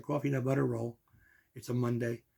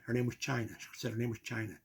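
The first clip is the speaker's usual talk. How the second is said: usual speaking voice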